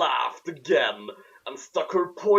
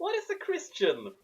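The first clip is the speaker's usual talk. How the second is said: much higher, softer wide-eyed question; innocent child; 11 semitones higher, 5 dB quieter than usual. Acted. Harry asking Hagrid innocently, much higher and softer. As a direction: ask it like a naive boy.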